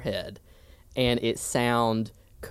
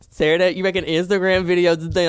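put-on teasing Southern accent, louder; mock Southern accent; 5 semitones higher, 5 dB louder than usual. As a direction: put on a Southern twang to tease her. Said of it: "Why do you give me a Southern accent?".

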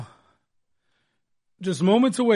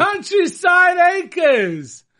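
high, loud, slow, drawn-out call; hyped-up shout; 11 semitones higher, 5 dB louder, much slower than usual. Pretend. Radio host echoing co-host's place name, hyped-up shout. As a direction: belt it out like a big announcer.

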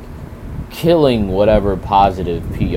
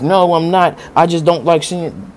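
mocking defensive denier; 8 semitones higher, 4 dB louder than usual. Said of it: "y'all are masking it like".

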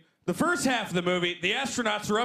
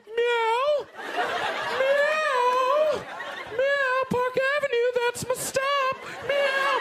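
much higher, loud cat-man mewing; cat guy meowing; 3 dB louder, much slower than usual. Retold or imitated. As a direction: meow like a grown man in cat ears. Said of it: "whiskers just going".

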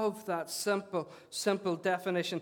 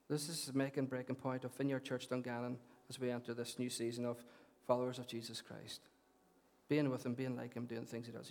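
hushed gravity; 6 semitones lower, 10 dB quieter than usual. Real, not acted. Serious announcement.

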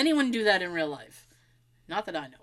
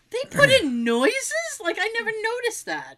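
surprised; 11 semitones higher than usual. Retold or imitated. Shocked discovery, after a gasp.

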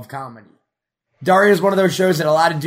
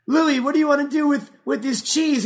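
curious friend; 10 semitones higher than usual. Retold or imitated. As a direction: ask Louis about the cheese. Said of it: "they'll be like".

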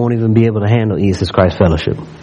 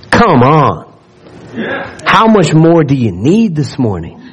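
higher, slower, building sermon delivery; preaching; 4 semitones higher than usual. Acted. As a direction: lift the scripture into a rousing preach. Rising reading into exhortation.

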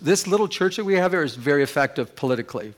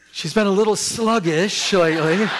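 laughing; 5 semitones higher, 5 dB louder than usual. Acted. Punchline of the joke.

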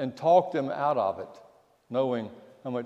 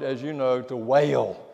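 ordinary talk, nothing marked

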